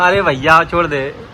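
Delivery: usual speaking voice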